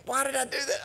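high, strained mock winded voice; out of breath. Papa John flat on the ground after falling, acted breathless regret. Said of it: "laying there out of breath going".

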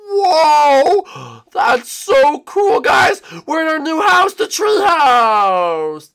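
high, loud, drawn-out cheer; excited shouting. Pretend. Showing the guys the new tree house, excited shouting. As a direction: whoop it out at the top of your range.

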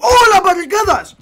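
high, loud sudden outburst; amazed, excited exclamation; delighted surprise. Real, not acted. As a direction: cry out at a surprise discovery. Spots the barricades mid-sentence.

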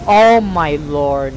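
loud exclamation. Real, not acted. Big spell hits.

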